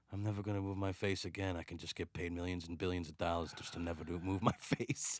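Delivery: low, quiet, barely moving mutter; Bruce Willis. Retold or imitated. Star bragging of easy money without acting, low murmur. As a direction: talk as if the face must stay still. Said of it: "where he was like".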